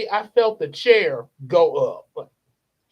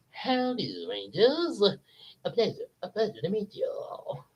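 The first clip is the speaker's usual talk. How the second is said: softer, courtly peanut greeting; Mister Peanut voice; 2 semitones higher, 7 dB quieter than usual. Retold or imitated. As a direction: greet the Rangers like a genteel gentleman. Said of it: "Mister Peanut shows up like".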